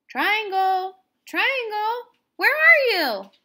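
high, loud calling into the dark. Acted. Circle calls for Triangle behind the waterfall: loud high calls. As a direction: call loudly for a lost friend. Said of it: "Circle called out".

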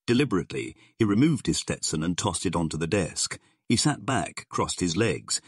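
plain reading voice

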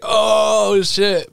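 long, high-pitched "oh" of amazement; hyped, drawn-out mock shock; acted amazed reaction. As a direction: play a reader floored by a twist. Acts out reader's shocked reaction.

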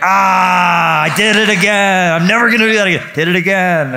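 high loud groan at yourself; self-scolding yell. Retold or imitated. Diner scolding himself after saying you too, loud and high. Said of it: "and you're like".

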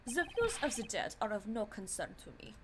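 put-on a light German accent for the cold prosecutor; lightly voiced German prosecutor, cold and dismissive; a hint of German accent, voicing Von Karma. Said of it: "I got the German accent now".

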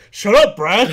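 loud gym bro yell. Acted. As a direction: bark it like a dumb jock. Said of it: "I'm trying to say it in a bro, a bro, uh, bro voice too".

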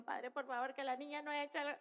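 hushed, hurried aside to God; soft mock-panicked prayer; secret inner prayer. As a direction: plead quickly under your breath. Acts parent's secret inner prayer.

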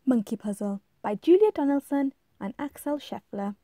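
ordinary delivery

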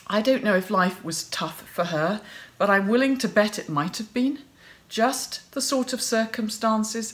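ordinary delivery

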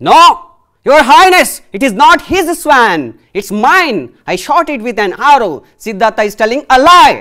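loud, high, insistent protest; angry protest. Acted. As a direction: fight back for the swan. Devdatta contradicts Siddhartha angrily.

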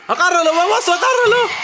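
high, loud mock-alarmed yell; panicked shout. Man to clumsy bearer as the load falls, panicked shout. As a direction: shout it as if something is toppling.

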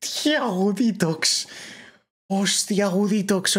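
high, squeaky mock Albertito voice; imitating Albertito. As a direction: parrot his high-pitched gamer voice. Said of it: "Albertito ponía la voz aguda".

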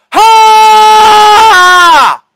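sharp high battle cry; anime brute. Shown as a demonstration. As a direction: explode with the final blast. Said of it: "Then shout".

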